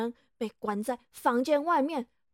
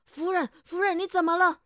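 alarmed; 3 semitones higher, 7 dB louder, faster than usual. Acted. Husband alarmed by wife's pain.

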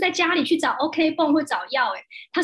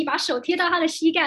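ordinary delivery

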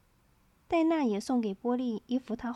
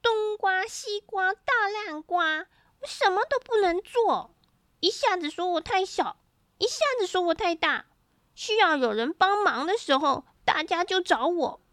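put-on higher-pitched child's angry rant; fuming little character's complaint; angry scolding; 7 semitones higher than usual. A narrator's reading. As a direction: scold indignantly in a high child's voice. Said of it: "气得大骂".

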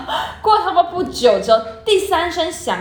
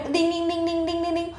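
alarm ringing imitation; 5 semitones higher, 6 dB quieter than usual.

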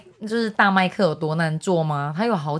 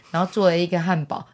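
usual speaking voice

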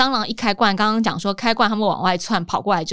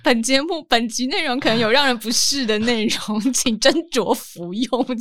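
mock warning label; 3 semitones higher than usual. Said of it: "你要上警语说本".

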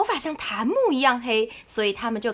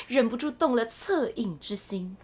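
plain reading voice

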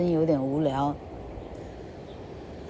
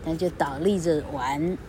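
usual speaking voice

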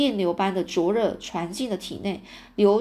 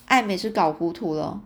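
ordinary delivery

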